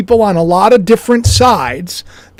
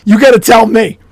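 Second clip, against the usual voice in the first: usual speaking voice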